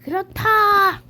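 squeaky NPC reply; high villager voice. Pretend. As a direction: answer high like a game villager. Villager answers in voice.

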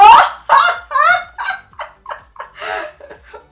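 long high belly laugh; high burst of laughter. Real, not acted. Laughing at the man caught out, high burst of laughter. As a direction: crack up helplessly.

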